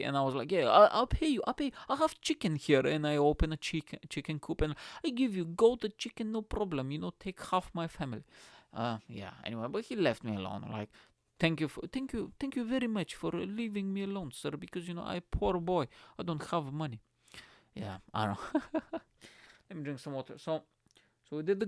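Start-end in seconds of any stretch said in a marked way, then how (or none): 0.5-8.2 high, loud mock village peasant with Eastern European accent; caricatured foreign accent, over-eager. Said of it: "really stereotypical Eastern European accent". 11.4-17.0 high, humble fake foreign accent; mock Eastern European accent, pitiful; pretend foreigner accent. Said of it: "I was pretending that I just came to the U K".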